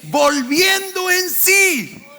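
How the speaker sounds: preacher raising the echoed line. Shown as a demonstration. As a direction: push it up for the crowd. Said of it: "Digan conmigo".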